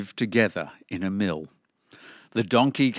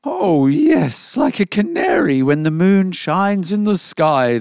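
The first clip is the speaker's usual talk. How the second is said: high, loud cat voice; boastful cat; 8 semitones higher, 7 dB louder than usual. A narrator's reading. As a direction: start with a high loud yowl of agreement.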